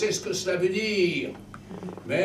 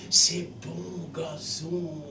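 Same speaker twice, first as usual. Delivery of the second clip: dreamy nostalgia; much slower than usual. Acted. Soul recalling the Sunday lawns, dreamy and slow. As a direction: linger on the memory.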